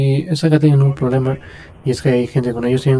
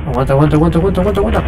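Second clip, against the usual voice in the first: urgent pleading; 5 semitones higher, 5 dB louder, much faster than usual. Real, not acted. Urging teammate during fight.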